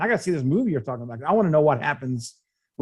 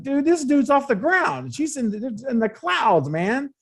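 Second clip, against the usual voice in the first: high-pitched, incredulous retelling; stunned disbelief, voiced high; acted amazed reaction; 10 semitones higher, slower than usual. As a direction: relive the astonishment with pitch way up. Acts out own amazed reaction while watching.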